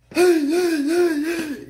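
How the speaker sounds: high, loud manic hey with a strange voice; goofy hollering. Retold or imitated. Showing how the bagger ran up, high manic holler. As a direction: holler like the wild-eyed bagger. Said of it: "He's like this".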